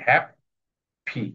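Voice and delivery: Happy broken in two, a long gap before the final "pi"; split into syllables. Shown as a demonstration. Teacher showing pupils the two syllables, happy split apart. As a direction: say happy as two separate beats, "hap ... pi". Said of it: "দুটো syllable এসেছে".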